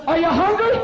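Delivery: high, loud holler at the guest; shouted question. Retold or imitated. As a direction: bellow a hospitable question like a threat. Pastor still shouting at guest.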